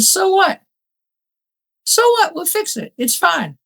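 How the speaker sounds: high, light mock-cheerful "so what"; retold reassurance. To husband after a spat, breezy high so-what. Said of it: "We looked at each other and said".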